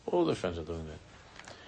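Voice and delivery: quiet mocking repeat; quiet echo. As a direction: drop it to a mumbling repeat. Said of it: "she's telling that all of her friends".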